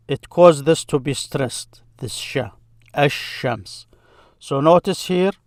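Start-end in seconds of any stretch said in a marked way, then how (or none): 2.9-3.9 ash-shams with the L turned into a doubled sh; assimilated sh. Shown as a demonstration. Teacher showing learners how sun letters sound, ash-shams with doubled sh. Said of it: "that's how you pronounce it".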